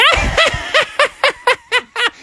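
very high villain cackle; screaming cackle. Acted. Anne closes in on the frogs, high screaming cackle. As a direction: shriek out a long wicked laugh. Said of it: "screaming out".

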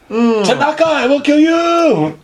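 high threat, last words drawn out slowly; hammed-up revenge cry with a stretched ending; mock vengeful threat. Man at the next door after the wrong Tanaka, mock threatening.

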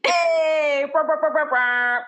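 loud, high hype ad-lib; hype call. Acted. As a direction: shout it like a DJ drop. Hype noise after title.

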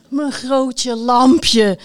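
loud, very drawn-out exclamation; crying out. A narrator's reading. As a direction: call it out slowly in disbelief. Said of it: "riep de ridster weer".

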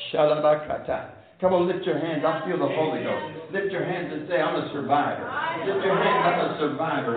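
low, soft, fervent urging; hushed exhortation. Real, not acted. Preacher feeling the Holy Ghost, hushed exhortation. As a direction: urge them on under your voice. Moved preacher urges congregation to respond.

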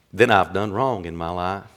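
low, soft, weighty sermon delivery; hushed preaching. Real, not acted. Preacher marvelling at Jesus before the congregation, hushed preaching. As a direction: drop right down and let it sink in.

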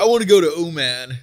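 loud hammed-up American accent on Oman; over-the-top American tourist voice. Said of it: "American accents just randomly".

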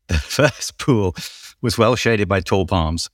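ordinary delivery

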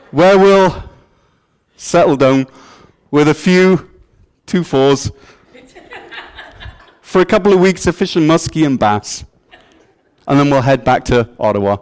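put-on folksy Canadian accent. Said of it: "The Canadian accent's difficult. Keep listening".

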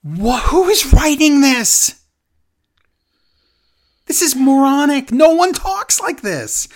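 can't believe who wrote this; incredulous disbelief. Real, not acted. Host ranting at the writers, high and incredulous. Host mocking bad promo writing.

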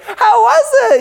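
a hint of the caring wife at the door; sweet wife. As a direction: pitch way up, gently asking like his wife. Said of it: "my sweet wife, like".

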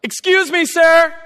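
high, loud call across the lot; yelling across a parking lot. Retold or imitated. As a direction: shout to a man far away. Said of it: "I hear somebody yell across the parking lot".

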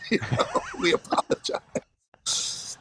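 breaking into an airy laugh. Real, not acted. Husband laughing while recalling old marriage days. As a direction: laugh through the apology, half whispered. Laughing at their past selves.

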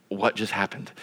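low, hushed bewilderment; stunned. Retold or imitated. Man left behind in the coffee shop, quiet stunned disbelief. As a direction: drop to a dazed undertone after a long pause.

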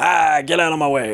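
hammed-up grouchy outburst; grouchy bar guy. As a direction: play a grumpy guy shoving past. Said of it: "like that grouchy guy from the bar".

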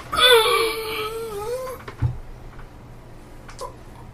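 high gasp of sudden pain; sharp pained gasp. Acted. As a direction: gasp as a cramp grips. NPC cramps and collapses.

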